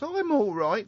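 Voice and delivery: imitating a Welsh accent she can't quite do; attempted Welsh accent. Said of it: "can't re-, do the accent".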